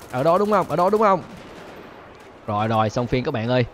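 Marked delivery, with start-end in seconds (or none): 0.1-1.2 higher, louder calling out; loud challenge. Real, not acted. Spotting where police shoot from, loud calling. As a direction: shout toward the shooter.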